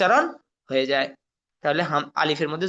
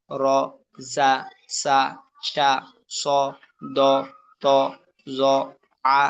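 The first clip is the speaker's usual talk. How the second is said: one syllable at a time, pause after each; separate, evenly spaced syllables; pronunciation only; slower than usual. Shown as a demonstration. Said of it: "শুধু উচ্চারণ পড়তেছি". (একটা একটা করে থেমে উচ্চারণ)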